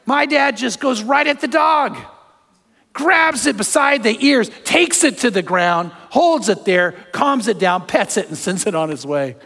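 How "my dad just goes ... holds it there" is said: excited retelling. Real, not acted. Preacher telling his congregation how his dad charged the dog, excited. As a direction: voice jumps high and loud with excitement.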